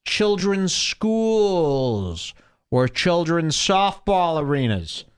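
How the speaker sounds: mocking, slow creep voice; Anthony Cumia. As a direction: stretch out the words like a creepy beggar. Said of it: "I'm Anthony Cumia".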